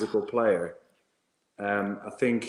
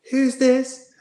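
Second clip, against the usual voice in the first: loud, very high Irish coach voice; exaggerated Irish accent, pitched way up; 7 dB louder than usual. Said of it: "I'll try an Irish accent".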